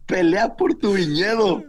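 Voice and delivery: high, slow hammed-up sermon slogan; mock preacher.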